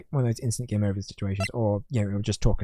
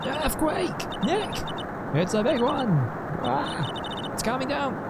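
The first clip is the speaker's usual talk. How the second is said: acted panic; much slower than usual. Warning Nick as the earthquake hits, high mock panic. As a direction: cry out in high, hammed-up panic.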